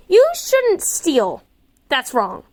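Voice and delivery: high, slow, prissy scolding; prim little boy. Acted. Little boy scolding a girl about stealing, high and slow. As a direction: lecture her like a goody-goody child.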